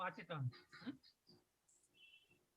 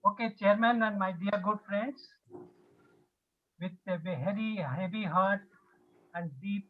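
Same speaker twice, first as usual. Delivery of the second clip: louder; 9 dB louder than usual. Real, not acted. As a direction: raise your voice so they hear. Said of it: "we are not able to hear you".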